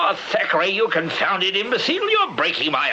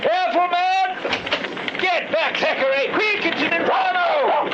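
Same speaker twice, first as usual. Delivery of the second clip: urgent shout over the fire; 12 semitones higher, slower than usual. Acted. Major shouting a warning at the blaze. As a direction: yell the warning across the flames.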